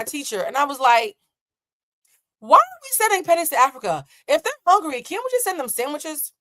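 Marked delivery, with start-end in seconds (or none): 2.4-6.3 put-on child voice. Kid questioning teacher, high pitched.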